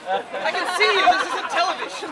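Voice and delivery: normal talking voice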